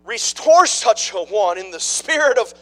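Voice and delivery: loud, high, slow scripture proclamation; a preacher thundering a Bible verse; full-force preaching, slowed. Acted.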